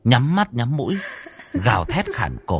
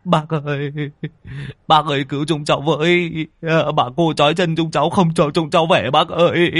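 crying plea; 6 semitones higher, 3 dB louder than usual. Acted. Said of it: "thì òa khóc".

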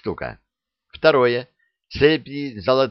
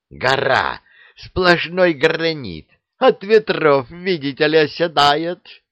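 raging; 6 semitones higher, 4 dB louder than usual. Acted. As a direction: spit out each word in fury. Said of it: "ярился Кощей". (яростно, громко и медленно)

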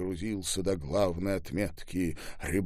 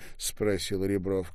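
ordinary delivery, nothing marked

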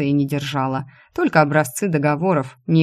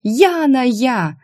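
high, drawn-out exasperated cry; nervous shout; 7 semitones higher, 4 dB louder, much slower than usual. A narrator's reading. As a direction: shout the correction, stretching it out. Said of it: "закричала от нервов".

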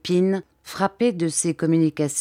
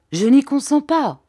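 sharp raised protest, higher and louder; vive exclamation; 8 semitones higher, 5 dB louder than usual. Acted. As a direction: cry out a firm refusal. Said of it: "s'écria vivement Sir Hassel". (s'écrier vivement, plus fort et plus aigu)

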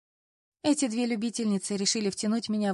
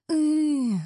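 loud, high wail of despair; wailing howl; 7 semitones higher, 5 dB louder than usual. A narrator's reading. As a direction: howl it out like a frustrated girl. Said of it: "взвыла я". (громкий жалобный вой)

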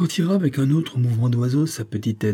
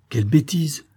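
pitched-up cry of annoyance; annoyed exclamation; 5 semitones higher than usual. A narrator's reading. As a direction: exclaim crossly, without shouting. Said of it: "s'écria-t-il en secouant la main". (exclamation agacée)

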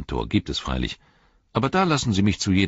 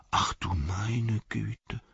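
startled whisper; 5 semitones higher, 4 dB quieter, much slower than usual. Acted. Old Hob startled.

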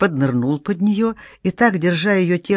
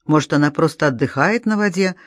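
ordinary delivery, nothing marked

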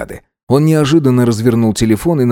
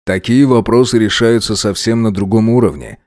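usual speaking voice

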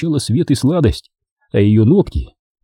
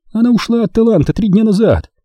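excited; 11 semitones higher than usual. A narrator's reading. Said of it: "взволнованно произнесла Дженни".

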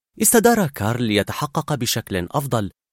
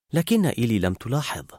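ordinary delivery

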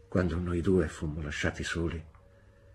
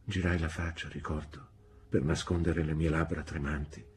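usual speaking voice

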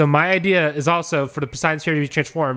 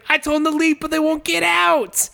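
Turtle impression; 12 semitones higher than usual. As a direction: whine it out in a high character voice. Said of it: "And it's like".

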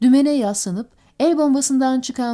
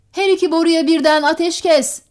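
raised, higher shouted order; 6 semitones higher, 6 dB louder than usual. A narrator's reading. Skipper ordering both guns to cease fire, shouted. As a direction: shout it out as a command. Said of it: "diye bağırdı".

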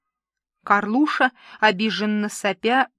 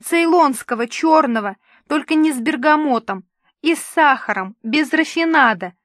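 high, loud, demanding whine; whining; 5 semitones higher, 5 dB louder than usual. A narrator's reading. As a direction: list demands like a spoilt star. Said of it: "захныкал Карлуша". (капризно хныча)

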